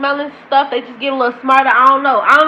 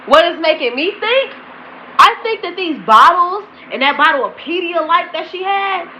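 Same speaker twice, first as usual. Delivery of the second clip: normal talking voice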